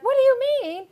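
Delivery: high, loud, startled what do you mean; a sharp jump up in pitch, caught off guard; surprised question. Retold or imitated. Host recalls asking sister, surprised and high.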